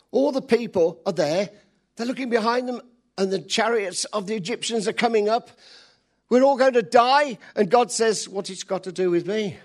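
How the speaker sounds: loud, high preaching voice; full-force preaching. Acted. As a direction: preach it big to the crowd.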